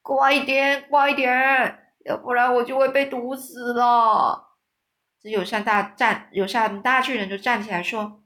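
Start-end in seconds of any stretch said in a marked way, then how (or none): 0.0-4.6 loud, higher desperate yelling; cowardly giant shouting to hurry before the poison kills him; frantic shout. A narrator's reading. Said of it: "大吼大叫说".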